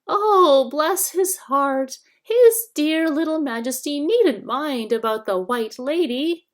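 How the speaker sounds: high, wheedling whine; shrill whining hag. A narrator's reading. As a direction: whine like a fawning old hag. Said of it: "the shrill, whining voice".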